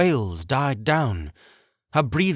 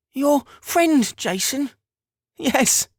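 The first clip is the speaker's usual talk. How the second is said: amazed mother voice; 5 dB louder, slower than usual. Acted.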